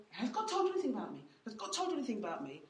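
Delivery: fast, nagging repeat of the question; mock nagging, quick and laughing; rapid mock pestering. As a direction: rattle it off like endless pestering. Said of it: "repeated pestering question".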